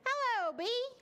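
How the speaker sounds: high-pitched singsong hello; boy's greeting. Acted. Boy in story greeting a bee, high-pitched hello. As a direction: call a high, friendly hello.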